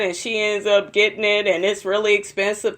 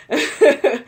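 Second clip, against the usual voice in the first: short real laugh, higher in pitch; amused chuckle; 6 semitones higher than usual. Real, not acted. Vlogger tickled by Heavenly's son comment, amused chuckle. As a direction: chuckle at your own remark. Laughs at her own remark.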